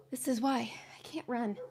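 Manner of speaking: whispered, still winded; breathless whisper. Real, not acted. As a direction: gasp it out without voice. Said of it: "I'm so unathletic".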